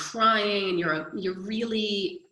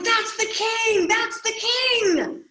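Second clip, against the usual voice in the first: much higher, louder cry of recognition, slowed on the repeat; excited shout; 4 dB louder, slower than usual. Retold or imitated. Townsperson in the crowd spotting the king, high excited shout. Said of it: "was like".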